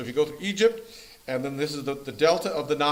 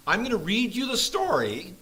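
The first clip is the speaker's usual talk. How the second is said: voice cracking; 5 semitones higher than usual. Real, not acted. Teacher announcing tonight's story, voice cracking upward. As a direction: voice jumps up and cracks. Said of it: "Puberty again".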